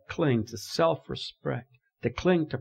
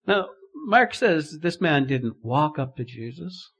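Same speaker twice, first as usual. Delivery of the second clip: preaching; 3 semitones higher, 4 dB louder than usual. Acted. Preacher raising voice.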